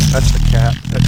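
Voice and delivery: deep mock voice. Blaming the noise on cat.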